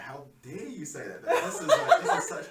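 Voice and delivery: loud, high, indignant outburst imitated through laughter; hammed-up outrage, voice shooting up; mock angry. Writer retelling an offended poet's retort to a critic, mock angry. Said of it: "this guy got really angry".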